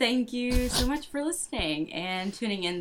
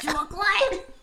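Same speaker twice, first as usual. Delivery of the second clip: mock cry; 12 semitones higher than usual. Echoing the mock last word.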